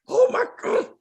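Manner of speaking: high-pitched gasping "oh my God"; mock shock. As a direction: squeal in pretend horror. Mock shock Lulu never showed.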